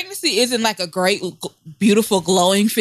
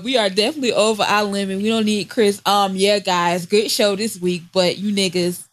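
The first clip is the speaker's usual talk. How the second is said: usual speaking voice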